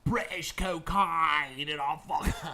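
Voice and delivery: hammed-up bad Cockney toast; Cockney accent. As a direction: ramble like a rowdy Cockney mate. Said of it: "I'll do it in Cockney accent".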